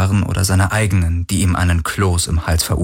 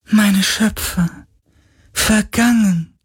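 high, slow wailing lament; lamenting ghost; slower than usual. Acted. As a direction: mourn it like a lost spirit. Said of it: "klagte der Geist".

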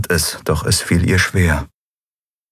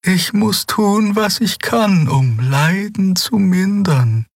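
put-on high, slow voice of a gentle old healer; old healer Xea; slower than usual. Xea, the old healer, speaking high and gentle.

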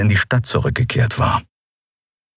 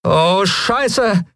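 much higher voice, falling curse drawn out; Lien's curse; 13 semitones higher than usual. Acted. Lien sees armed pirates charging, dismayed curse. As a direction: let the curse sink in dismay. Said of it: "sagte jetzt auch Lien".

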